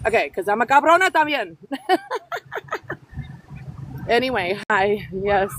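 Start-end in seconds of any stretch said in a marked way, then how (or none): 1.7-4.0 a bright, high burst of laughter. Real, not acted. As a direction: laugh up high at your own cheek. Laughing at own sass.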